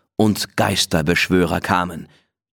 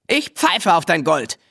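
higher, cheeky defiance; defiant boy; 8 semitones higher than usual. Acted. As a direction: wave off the gold boldly. Wild Willi refuses the gold.

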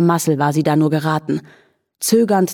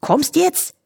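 calling out; 10 semitones higher than usual. A narrator's reading. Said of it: "rief der Floh".

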